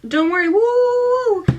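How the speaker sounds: loud, high swooping "woo"; mock mystical woo. Retelling her brushing off worry, mock whooping. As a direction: whoop it out as a joke. Retold reassurance with imitated woo.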